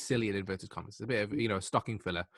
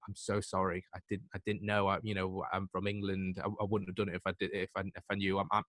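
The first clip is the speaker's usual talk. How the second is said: soft, low stammered apology, retold; small, halting voice of someone owning up; meek apology; 3 semitones lower, 6 dB quieter than usual. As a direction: apologize quietly, as if hearing yourself from outside. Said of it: "my own voice going".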